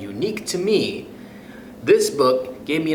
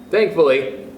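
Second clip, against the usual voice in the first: plain reading voice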